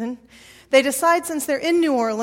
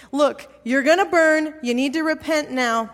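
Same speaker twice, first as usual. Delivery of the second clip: louder, higher mock hellfire warning; retold pushy evangelist, punching the opening; impatient warning; 4 semitones higher, 5 dB louder than usual. Kid with pamphlets hurrying to finish before Cajun food, impatient warning. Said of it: "and he said".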